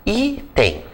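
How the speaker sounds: Item split in two, high stressed i, then a falling tem; stress on the first syllable. Shown as a demonstration. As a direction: say Item slowly as I... tem, leaning on the i. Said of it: "Qual é a sílaba tônica?".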